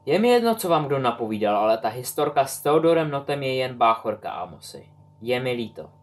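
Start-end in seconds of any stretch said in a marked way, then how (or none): none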